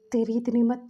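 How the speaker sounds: loud, accusing challenge; angry confrontation. Acted. Lajan storms into the hospital room: loud angry accusation. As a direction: demand it with outrage, voice up.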